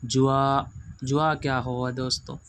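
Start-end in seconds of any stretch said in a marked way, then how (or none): 0.0-0.6 "جوا" with a j and w glide; mistaken j sound. Retold or imitated. Teacher imitating a reading that spoils the letter, j sound. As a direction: imitate pupils misreading zwa as "جوا". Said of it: "حرف دوستو خراب ہو گیا".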